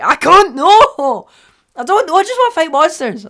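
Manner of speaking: high, loud, hammed-up protest; overwhelmed hero wailing his refusal; mock panic. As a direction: cry out in comic desperation. Acts torn man refusing to choose.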